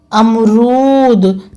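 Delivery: अमरूद said very slowly and drawn out; अमरूद stretched like dictation. Shown as a demonstration. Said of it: "dictation word for pupils".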